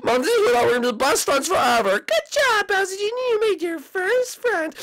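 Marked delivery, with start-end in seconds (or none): 2.0-4.7 high, slow praising mother voice; proud mom. Acted. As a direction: praise your son warmly, slowly.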